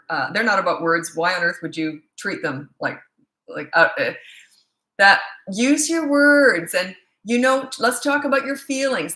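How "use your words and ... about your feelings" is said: high, slow, syrupy mock-sweet parent voice; mock gentle parent. Mocking parents who lecture toddlers, high and sugary. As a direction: parody the over-talking parent.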